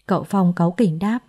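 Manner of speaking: ordinary delivery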